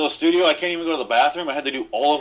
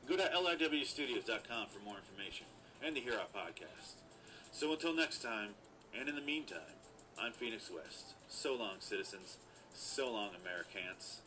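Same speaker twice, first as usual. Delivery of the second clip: softer, mock-heroic sign-off; announcer outro; 2 semitones lower, 11 dB quieter than usual. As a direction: read it like a hokey radio host. Podcast outro read.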